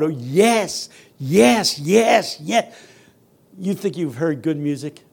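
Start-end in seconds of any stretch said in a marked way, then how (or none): 0.0-2.7 higher, louder cries of approval; grandmothers' joyful moans. Retold or imitated. Grandmothers hearing of Juilliard, joyful moaning approval. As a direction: imitate overjoyed church grandmothers.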